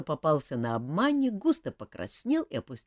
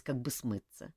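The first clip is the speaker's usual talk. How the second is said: ordinary delivery, nothing marked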